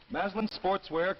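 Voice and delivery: normal talking voice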